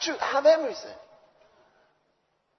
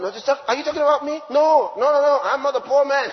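quick, loud, higher no-no-no; mock indignant protest; 5 semitones higher, 5 dB louder than usual. Preacher pretending offence at being called poor. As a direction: playfully wave the pity away.

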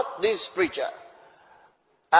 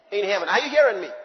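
usual speaking voice

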